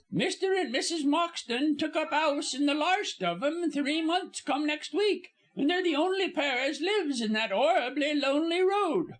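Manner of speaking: high-pitched chattering servant, dropped aitches; high, scandalised working-class gossip; gossiping Cockney servant woman. Acted. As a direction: prattle on indignantly in a high Cockney voice. Narrator plays the gossiping servant.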